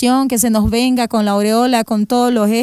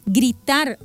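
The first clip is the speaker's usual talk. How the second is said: normal talking voice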